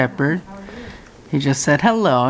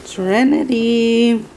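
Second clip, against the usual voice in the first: sweet call; 7 semitones higher than usual. Real, not acted. Calling puppy sweetly.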